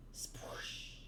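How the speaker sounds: very soft, airy whooshing spoosh; whispered spoosh effect. Acted. Narrator voices the comic's sound effect, whispered whoosh. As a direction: imitate a soft gust in a whisper.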